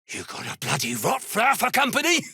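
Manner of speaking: fast, high-pitched disbelieving question; indignant, rising burst of disbelief; incredulous challenge. Acted. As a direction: blurt it out quickly, pitch climbing.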